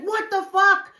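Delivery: high, loud re-enacted disbelief; shocked yell. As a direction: shout it in stunned alarm. Retells own shock driving away.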